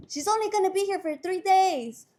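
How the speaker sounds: high, loud retold protest; imitated quarrelling inmate. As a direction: argue it across the cell. Acts inmate arguing in jail.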